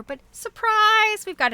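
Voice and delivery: high, loud playful "surprise!"; spring the reveal like a party surprise; mock surprise. Real, not acted. Reveals unexpected pattern in book.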